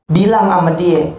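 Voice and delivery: ordinary talk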